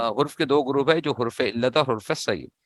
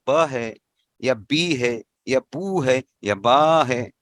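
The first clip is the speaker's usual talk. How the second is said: ब, बी, बू and बा spaced out slowly; slow vowel listing; much slower than usual. Shown as a demonstration. Teacher slowly comparing the vowel sounds. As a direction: lay out ब बी बू बा one by one. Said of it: "ये pronunciation वो हरकत से पता चलता".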